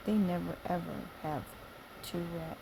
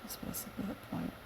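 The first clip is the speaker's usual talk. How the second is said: whisper; 8 dB quieter, much faster than usual. Real, not acted. Whispered fast aside.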